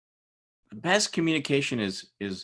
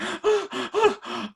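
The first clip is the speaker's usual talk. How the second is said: big high gasp for air after a sprint; gasping runner catching breath; exhausted gasp; 3 dB louder than usual. Retold or imitated. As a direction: gasp like a runner at the finish line. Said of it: "he was like".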